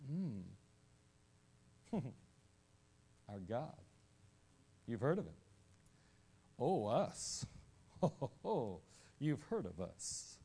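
low, slow chuckling aside; smug aside. Retold or imitated. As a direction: Gibeonites gloating to each other. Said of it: "all along they're going".

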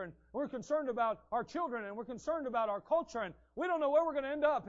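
worked-up people begging Aaron to act; anxious crowd. Acted. Worried people plead with Aaron.

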